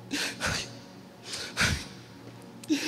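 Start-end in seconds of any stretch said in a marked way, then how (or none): none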